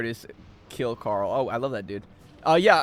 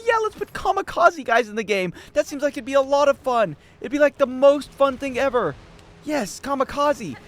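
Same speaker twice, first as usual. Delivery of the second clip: sarcastic; 9 semitones higher, 4 dB louder than usual. Real, not acted. Sarcastic mockery of game design.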